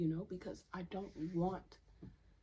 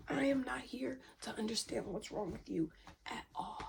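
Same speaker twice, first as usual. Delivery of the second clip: ordinary talk, nothing marked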